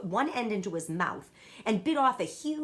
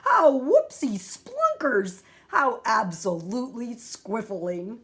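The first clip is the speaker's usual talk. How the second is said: much louder, higher, slow, joyful exclaiming; a giant beaming over praise; beaming delight; 4 semitones higher, 7 dB louder, slower than usual. A narrator's reading. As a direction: burst out with gleeful joy. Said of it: "still beaming".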